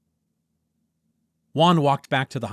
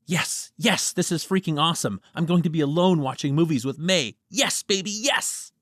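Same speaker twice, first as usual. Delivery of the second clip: higher, excited private cheer; gleeful celebration; 8 semitones higher, 4 dB louder than usual. Acted. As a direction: celebrate like a kid who got lucky. Juan celebrating alone.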